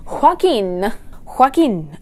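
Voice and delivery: Joaquin said slowly with a breathy h before the w. Shown as a demonstration. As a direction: sound out Joaquin as hwah-KEEN.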